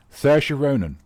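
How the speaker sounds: ordinary talk, nothing marked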